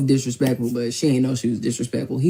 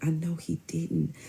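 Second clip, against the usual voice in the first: slow, soft disbelief; quiet disbelief; 3 semitones higher, 8 dB quieter, slower than usual. Real, not acted.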